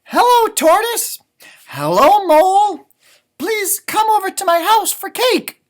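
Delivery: high, loud cheerful rabbit voice; a bright, eager invitation; Rabbit inviting. Acted. Rabbit inviting Tortoise and Mole to cake, high loud.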